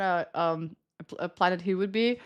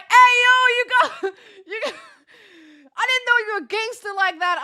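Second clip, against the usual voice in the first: high, laughing hype; imitated hyped-up guy; 11 semitones higher, 6 dB louder, faster than usual. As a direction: crack up while imitating his amazed shout. Acts out guy seeing her retainer.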